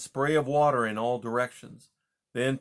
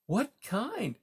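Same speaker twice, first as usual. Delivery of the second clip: alarmed mouse; 11 semitones higher than usual. A narrator's reading. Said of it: "Poppy cried, unable to do more than echo".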